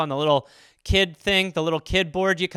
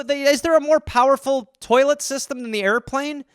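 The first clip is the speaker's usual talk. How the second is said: incredulous rant; 7 semitones higher than usual. Real, not acted. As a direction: pitch it up in disbelief. Ranting about airplane toilets.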